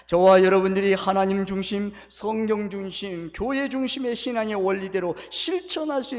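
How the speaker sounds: loud fervent benediction; full-force preaching. Real, not acted. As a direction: proclaim the blessing at full volume.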